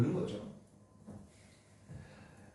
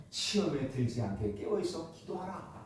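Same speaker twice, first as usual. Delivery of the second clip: soft solemn warning; 3 semitones lower, 7 dB quieter than usual. Retold or imitated. Jesus warns disciples.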